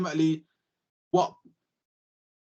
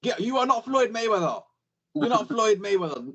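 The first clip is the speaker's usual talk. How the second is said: higher mock yell of a boxing coach; 7 semitones higher than usual. Amateur boxing coach scolding a fighter on the ropes, mock higher yell. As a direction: bark it like a gym coach telling off a fighter, half in jest. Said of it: "they'll say".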